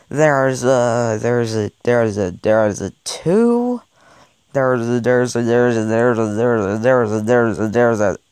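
low, slow drawn-out stalling; low teasing countdown; low suspense drone. Acted. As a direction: drag out the suspense before the number.

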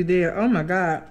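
muffled through fingers; laughing behind covered mouth; speaking behind hand. Real, not acted. Seen 3: Hand covers mouth 2.4–3.8 and again 6.6–7.3 while laughing.

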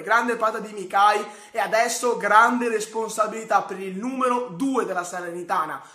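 usual speaking voice

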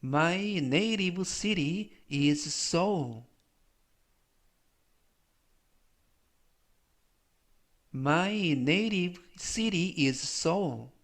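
slow, measured English for learners; slow model reading. Shown as a demonstration. Teacher reading an English example for learners, slow model reading. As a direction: read it out slowly as a pronunciation model.

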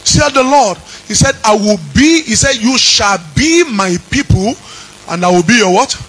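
singing preacher's chant over music; fervent preaching. Acted. Pastor stirs the congregation with a sung preaching chant. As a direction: preach it out, lifting each phrase.

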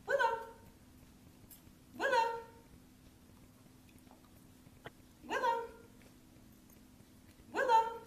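high, bright sing-song call of the name; happy name call. Shown as a demonstration. Trainer calling her puppy's name happily. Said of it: "in a happy tone of voice".

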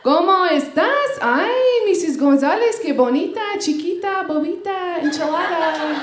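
sing-song Spanish fussing, swooping pitch; gushing greeter. Acted. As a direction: gush over a patient in mock Spanish. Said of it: "I know who's greeting me at the door".

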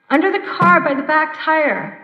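trapped under a car, calling to the men. Retold or imitated. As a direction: shout your location from under the car. Trapped driver calling to rescuers.

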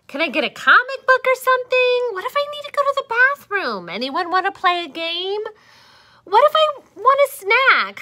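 high, fast, loud rapid-fire pleading; impatient whining. Acted. Caterpillar pesters about comics, bathroom, snacks, fast and high. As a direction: rattle off demands like a restless kid.

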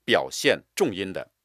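normal talking voice

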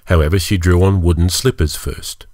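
plain reading voice

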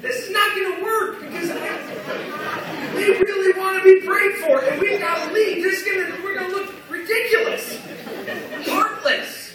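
loud, high comic alarm; panicked disbelief. Retold or imitated. Preacher thinking as the crowd rushes up for prayer, panicked disbelief. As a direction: exclaim it in mock panic, pitch way up. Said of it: "And now I'm thinking".

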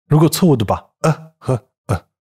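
usual speaking voice

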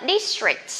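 district said clearly with the s and tr; model district. Shown as a demonstration. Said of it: "khó với các bạn".

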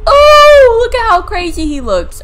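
spooked gamer; shocked exclaim. Real, not acted. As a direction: yelp in shock then point it out. Shocked at creepy figure.

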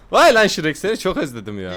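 loud, high delighted cry; sevinçle bağır; excited. Real, not acted. Greets Shrek excitedly. (heyecanlı selam)

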